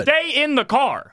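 high, drawn-out yell; long high-pitched order; yelling at comedians. Acted. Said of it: "I could yell at comedians".

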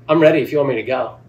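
loud, called across the course; calling out. Retold or imitated. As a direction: call it out to him. Golfer calling out to partner.

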